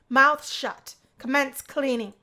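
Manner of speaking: loud, clipped command; furious dorm supervisor; angry order. Acted. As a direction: bark the order at them. Dominic yelling at roommates.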